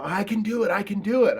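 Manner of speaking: high, eager, repeated claim; eager mock insistence. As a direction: pitch it up like an overeager student. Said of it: "So people say".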